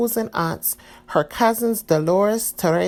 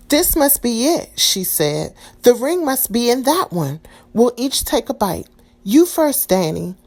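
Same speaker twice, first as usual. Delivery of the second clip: excited; 6 semitones higher, 4 dB louder than usual. A narrator's reading. Maria excited over last tamale.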